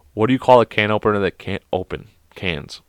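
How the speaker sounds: usual speaking voice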